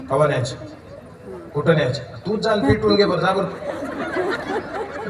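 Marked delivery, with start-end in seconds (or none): 2.2-5.1 laughing mockery. Mocking village mourners.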